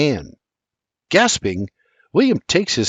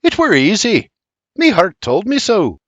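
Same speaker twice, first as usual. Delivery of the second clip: a hint of the Scot, raised and sincere; earnest old Scotsman; 8 semitones higher, 5 dB louder than usual. A narrator's reading. Old Scot telling William how he knew, raised and sincere. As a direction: speak from the heart as the old Scot. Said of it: "looks him in the eyes and says".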